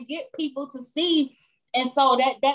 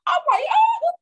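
high, loud laughing shout; delighted shock; 6 dB louder than usual. Real, not acted. Telling a friend about bills paid, laughing shout. Surprise at bill being paid.

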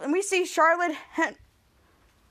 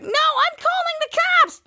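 very high, drawn-out yell; acted furious threat; 12 semitones higher, slower than usual. Girl wrestling boy for her phone, mock furious yell. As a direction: stretch the threat out in a high shriek. Piper threatens during tussle.

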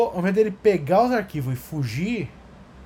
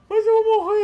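put-on whiny kid.

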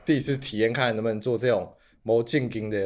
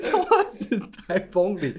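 giggling through the words; laughing while speaking; 7 semitones higher, slower than usual. Real, not acted. Laughing through tongue-twister name.

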